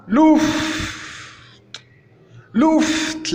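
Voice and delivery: high-pitched "louft" with a long f and crisp t; demonstrated pronunciation. Shown as a demonstration. Teacher giving an example word for U, demonstrated pronunciation. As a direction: model "louft" high, lingering on the f.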